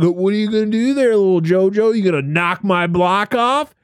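high, loud taunting voice; mock biker taunt. Biker taunting the clown in boxing gloves, high and loud. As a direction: goad the little clown into a fight. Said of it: "and he's like".

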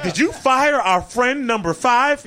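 high, slow, drawn-out accusing question; mock outrage. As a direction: stretch it out in high mock disbelief. Mock confronting the boss as staff.

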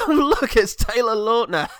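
high, loud mock announcement; mock excited pointing-out. Mocking the joke in everything he appears in, loud fake excitement. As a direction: point out the star as a hammy running joke.

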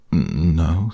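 plain reading voice

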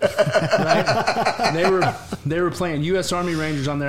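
high, giggly disbelief; real laughter while telling the host the punchline; laughing. Real, not acted. Laughing at own story.

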